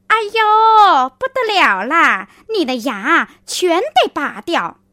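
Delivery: high, loud fake alarm; shocked cry. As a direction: cry out in mock horror. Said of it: "就叫了起来".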